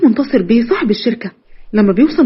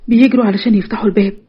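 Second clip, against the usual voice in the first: usual speaking voice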